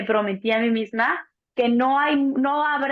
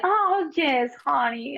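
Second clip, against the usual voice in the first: mock American nurse, higher on oh yes; imitating a condescending gringa nurse; 3 semitones higher, much slower than usual. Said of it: "la gringa esa que me, me dice". (enfermera gringa condescendiente, imitada)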